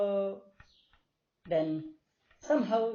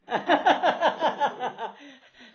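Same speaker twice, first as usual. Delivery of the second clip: hearty laugh; 7 semitones higher, 9 dB louder than usual. Real, not acted. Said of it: "We can't repeat what you said".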